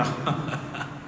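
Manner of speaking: short breathy scoffing laugh; a quiet disbelieving chuckle as Gideon; soft unbelieving laugh; mock laugh. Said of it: "Gideon ఇట్లా నవ్వి".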